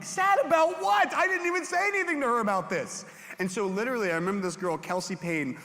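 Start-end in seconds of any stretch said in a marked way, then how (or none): 0.0-3.0 much higher and louder, bewildered; high incredulous protest. Retold or imitated. Youth pastor as his young self, high and baffled. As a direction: squeal it in disbelief.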